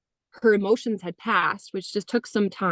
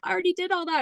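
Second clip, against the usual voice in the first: put-on high, light child's voice; lightly voicing a young daughter; daughter's voice; 10 semitones higher, much faster than usual. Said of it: "and she's like".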